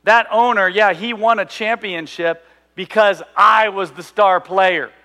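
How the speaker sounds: loud, smug star player boasting; mock bragging athlete. Preacher comparing Samson to a bragging athlete, in a smug boast. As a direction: brag like you carried the team. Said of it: "that common thing of like".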